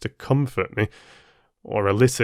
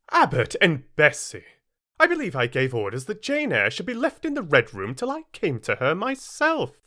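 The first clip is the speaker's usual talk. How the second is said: raised, cold, commanding voice; stern mistress; 6 semitones higher than usual. Acted. Mrs Reed scolds the maids for letting Jane out, stern and cold. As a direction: rebuke the servants for disobeying.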